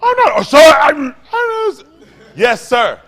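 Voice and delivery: high, loud hammed-up panic; mock flustered shout. Acted flustered clerk, shouting.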